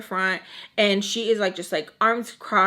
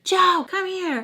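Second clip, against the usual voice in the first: high, drawn-out call to someone across the room; imitated holler for someone; 9 semitones higher, 4 dB louder, slower than usual. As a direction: summon them over, slow and higher. Woman calling Joe over.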